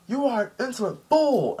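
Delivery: high-pitched mock villain outburst; villain's rebuke. Akainu attacking Ace, retold.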